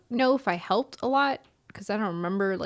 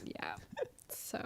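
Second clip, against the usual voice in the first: whispered awkward aside; 20 dB quieter than usual. Real, not acted. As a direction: breathe it out under the breath.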